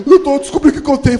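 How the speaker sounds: fast, loud and high; breathless kid. Retold or imitated. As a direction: rush it in panic.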